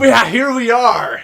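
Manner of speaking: hammed-up announcer. Hammy welcome continues.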